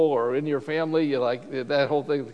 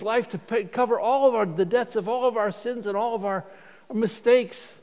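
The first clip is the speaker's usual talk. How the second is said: higher, fervent preaching as Paul; impassioned preaching; 4 semitones higher than usual. Acted. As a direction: plead with the king, heart wide open. Voices Paul's impassioned appeal.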